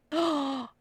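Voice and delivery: sharp gasp of realisation; sudden gasp. Real, not acted. Remembering the forgotten jade bracelet, sudden gasp.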